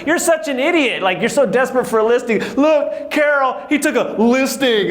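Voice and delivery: high, taunting "you're such an idiot"; mocking family.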